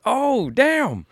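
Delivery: high, startled "oh damn"; a hammed-up jolt of surprise; mock surprise. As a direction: play it like you've just been caught off guard.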